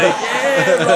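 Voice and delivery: very high, loud mock hollering; hyped greeting. Hyped man answers the crowd, retold whoop. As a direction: yell back to the cheering crowd.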